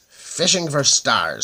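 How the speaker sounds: put-on grumpy little old man snapping at the prince; snapped. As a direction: bite the words off at a nosy boy. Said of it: "snapped the little bent man".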